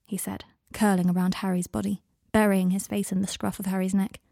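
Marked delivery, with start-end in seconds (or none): none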